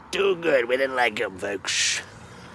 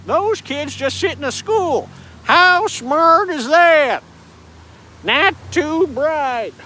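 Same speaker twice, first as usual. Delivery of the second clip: higher, slow Southern comic drawl; hint of Foxworthy, drawn out; Jeff Foxworthy impression; 7 semitones higher, 3 dB louder, slower than usual. Vlogger mocking Jeff Foxworthy, slow Southern drawl. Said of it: "how you from South".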